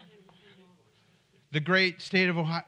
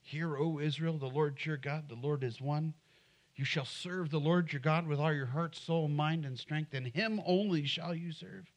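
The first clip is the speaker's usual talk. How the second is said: recited Shema; 5 dB quieter than usual. Shown as a demonstration. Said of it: "Do my children know the Shema".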